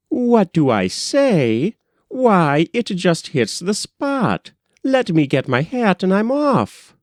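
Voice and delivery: bursting to get going; eager Cornelia. A narrator's reading.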